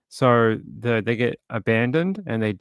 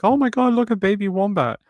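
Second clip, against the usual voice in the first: put-on gushing girl; 10 semitones higher, 3 dB louder than usual. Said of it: "the girl picked up the wombat and was like".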